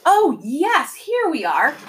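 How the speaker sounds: high, loud burst of sudden realisation; startled creature. A narrator's reading. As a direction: exclaim as if just reminded. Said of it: "The creature looked startled".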